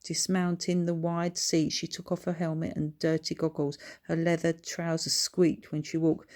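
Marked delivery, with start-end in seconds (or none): none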